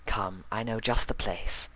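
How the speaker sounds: Rarity gently nudges Spike along. A narrator's reading. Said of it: "gently nudging him".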